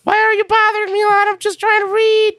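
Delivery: high-pitched cartoon squeak; Rusev. Wrestler pestered by Lana while reading, high-pitched squeak. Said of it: "in a high pitched voice".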